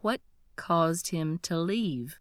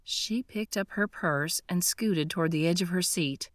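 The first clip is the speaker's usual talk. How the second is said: ordinary delivery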